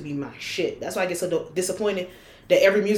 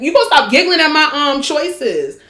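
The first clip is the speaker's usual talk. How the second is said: higher, louder and quicker, playfully indignant; mock offended; 8 semitones higher, 8 dB louder than usual. Real, not acted. Teasing her co-host for giggling, mock indignant. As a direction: fake outrage at being laughed at.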